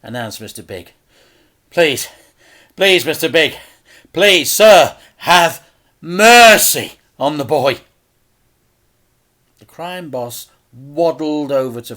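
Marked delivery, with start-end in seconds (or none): 1.7-7.8 loud, higher begging; desperate pleading. Acted. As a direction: plead loudly for the boy's life. Father begging the crime boss.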